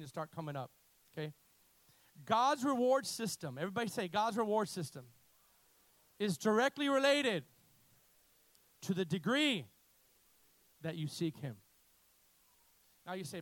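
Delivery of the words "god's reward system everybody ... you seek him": preaching. Acted. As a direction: hammer each phrase home. Preaching with long pauses.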